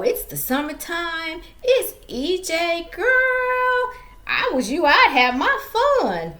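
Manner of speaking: put-on high, drawn-out singsong girl talk; imitated girlfriend voice, stretched and high; sassy girlfriend. Acts out girlfriend egging her on.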